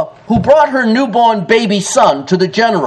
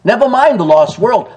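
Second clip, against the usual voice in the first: normal talking voice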